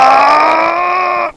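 high anime power-up yell; power-up scream. Pretend. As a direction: scream like powering up Super Saiyan. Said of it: "I'm charging my power level".

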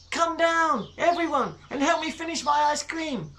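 put-on high-pitched little girl calling. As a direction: call everyone down cheerfully.

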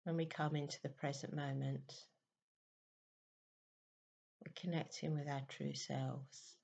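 very soft, unhurried meditation voice with long pauses; guided meditation. Real, not acted. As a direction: gently guide listeners into stillness, hushed and even.

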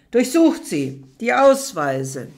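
loud roared command to the guards; bellowing order. Acted. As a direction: bellow at the guards to search her. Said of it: "Er ruft brüllend die Wachen herein".

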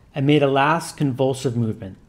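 ordinary delivery

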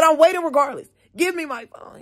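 high, loud defiant comeback; angry retort, retold. Student told to wait for phone, high retold angry retort. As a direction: snap back high and insistent.

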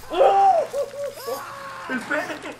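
high, loud shriek of horror; real disgusted shock, shrieking; shocked scream. Real, not acted. Gamer sees a gruesome cut in the horror game, shrieking.

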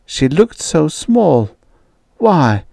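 ordinary delivery, nothing marked

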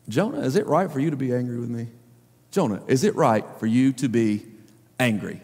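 like a father teaching his son; calm reproach without anger; gentle fatherly God. Retold or imitated. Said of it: "trying to teach his son a lesson".

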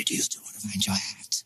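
quick, soft, ghostly whisper of "hat". A narrator's reading. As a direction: hiss the question out fast and secret. Echo repeated in whisper.

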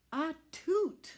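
high, loud sneezing toot; squeaky tugboat sneeze. Acted. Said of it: "sneezed Alice loudly".

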